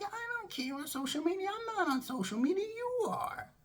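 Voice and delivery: very high, quick argumentative comeback; exasperated retort. Retold or imitated. Employee arguing with the boss over social media, exasperated.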